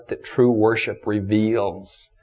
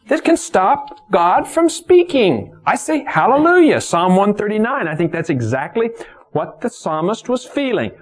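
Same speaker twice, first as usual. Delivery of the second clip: high, loud, exultant preacher's cry; full-force preaching; 12 semitones higher, 4 dB louder than usual. Real, not acted. Preacher tells the congregation God still speaks, full-force preaching. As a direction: preach it high, stretching the big words.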